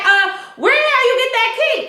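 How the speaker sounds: high, indignant retold demand; mock outraged Esperanza. Recapping the show, voicing Esperanza's outrage at Ed.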